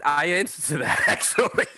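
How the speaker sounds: high, laughing answer. Real, not acted. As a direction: giggle through it. Laughing reply.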